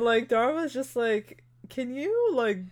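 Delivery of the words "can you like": high-pitched mock exasperation; exasperated request. Retelling Dharma brushing off the nurse, exasperated. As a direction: plead for him to get lost.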